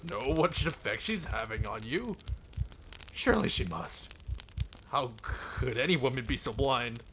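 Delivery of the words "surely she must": ordinary talk, nothing marked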